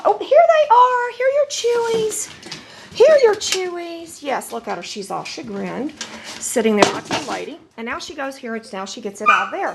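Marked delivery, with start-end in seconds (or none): 0.0-4.2 playful excitement. Real, not acted. Owner playfully asking her dog where the chews are, high excited baby talk. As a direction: tease the dog with a squeaky, excited search.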